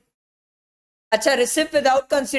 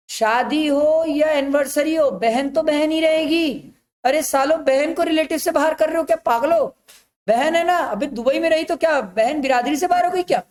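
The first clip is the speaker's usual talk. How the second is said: higher-pitched, indignant teacher's telling-off; worked-up rhetorical questions, voice raised in pitch; exasperated scolding; 3 semitones higher than usual. Real, not acted. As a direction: scold the class in exasperated disbelief. Teacher mock-scolds class over sister.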